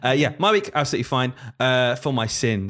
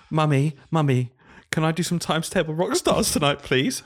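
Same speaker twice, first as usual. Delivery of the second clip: put-on pleading child; 4 semitones higher than usual. Teacher voicing a pupil begging mum, high and pleading. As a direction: beg Mummy like an eager child. Child voiced asking parent.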